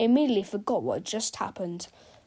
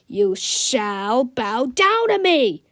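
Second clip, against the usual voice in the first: slow, louder villain's yell; 7 dB louder, much slower than usual. A narrator's reading. Melvin yelling at Captain Underpants, slow and loud. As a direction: drag out each word as a booming threat. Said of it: "Big Mel yelled".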